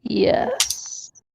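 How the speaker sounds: voiceless deadpan whisper; just breathe the yes. Pretend. Co-host whispers yes to leave host hanging.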